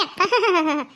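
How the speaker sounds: scornful chuckle; a short derisive laugh; mother-in-law laughs at the daughter-in-law's fear; mocking laugh. Mother-in-law mocks her fear.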